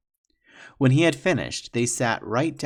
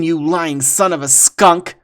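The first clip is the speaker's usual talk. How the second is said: contemptuous accusation; 6 semitones higher, 12 dB louder, slower than usual. Acted. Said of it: "said Two Strike".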